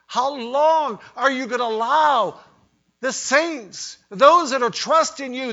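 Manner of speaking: high, loud, impassioned plea to God; pleading cry. Acted. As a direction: beg God for an answer. Voicing saints' pleading prayer.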